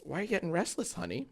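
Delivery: high and soft to the dog; soft pet voice. Real, not acted. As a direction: coo at the restless puppy. Talking to the dog.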